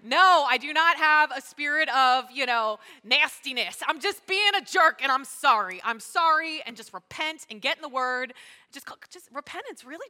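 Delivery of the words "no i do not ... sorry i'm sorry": loud, slow, deliberate admission; emphatic confession. Acted. Pastor modelling owning up to being a jerk, emphatic. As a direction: own it loudly, word by word.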